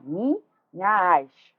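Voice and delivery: slow "minhas" in two beats, the vowels fused in "nhas"; syllables joined. Shown as a demonstration. Teacher demonstrates elision, slowly fusing the vowels at the end of "minhas". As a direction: stretch "minhas" as mi, then a long merged nhas. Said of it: "eu vou juntar".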